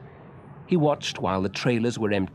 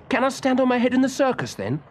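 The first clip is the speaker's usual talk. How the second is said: much higher, fast and eager; eager boy; 4 dB louder, faster than usual. A narrator's reading. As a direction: beg quickly like an excited little boy. Willie eager question.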